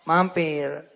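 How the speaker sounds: normal talking voice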